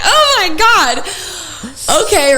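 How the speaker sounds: half-laugh, half-panic at being asked to call. Retold or imitated. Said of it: "I'm like".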